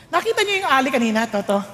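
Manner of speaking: usual speaking voice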